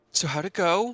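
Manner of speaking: bright curious question; eager question. Acted. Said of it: "looks at Koro".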